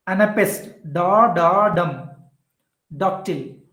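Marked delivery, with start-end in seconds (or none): 0.9-2.1 slow "da da dum", landing on the final "dum"; two drawn beats "da da", then a firm "dum"; stress on dum. Shown as a demonstration. Teacher demonstrating anapest, stress on final "dum". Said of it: "So that was da".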